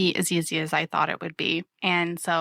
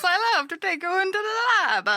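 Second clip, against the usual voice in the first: much higher, laughing mock Italian; squeaky mouse inviting you into the lab; giggling Italian mouse voice; Mario mouse; 10 semitones higher than usual. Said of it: "Mario the mouse".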